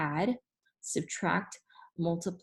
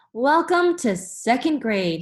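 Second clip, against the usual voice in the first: louder, slower, beaming principal greeting; cheerful principal; 6 semitones higher, 6 dB louder than usual. Acted. Principal to second graders, booming a cheerful welcome. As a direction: welcome the kids like a jolly host. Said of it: "he said cheerfully".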